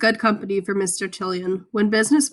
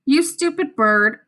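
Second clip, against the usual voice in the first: parrot voice; 5 semitones higher, 3 dB louder, slower than usual. A narrator's reading. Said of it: "said the parrot".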